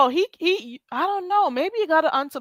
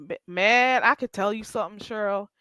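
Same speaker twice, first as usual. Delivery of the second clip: ordinary talk, nothing marked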